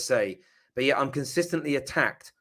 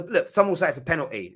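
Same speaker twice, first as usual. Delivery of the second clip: ordinary talk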